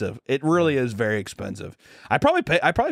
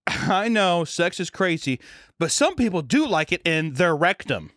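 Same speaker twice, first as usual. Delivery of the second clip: higher, deliberate teacher voice; imitating health teacher; 5 semitones higher than usual. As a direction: spell it out to a snickering class, pitched up. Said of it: "there's a health teacher who was, like, a volleyball coach".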